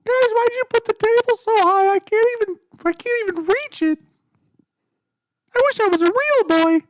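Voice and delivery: mock a short friend in a tiny high voice; Cam impression. Impersonating Cam complaining about the high table. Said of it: "We're gonna do a Cam impression".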